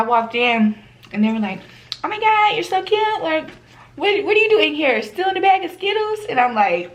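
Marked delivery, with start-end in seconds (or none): 2.0-6.2 gushing inmates. Retold or imitated. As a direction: coo over the cute newcomer. Inmates greet her gushing.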